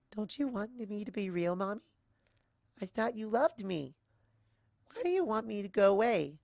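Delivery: a small son asking why mommy wants him gone; child's hurt plea. Acted. Leafy asking his mother why she wants him gone, high child voice.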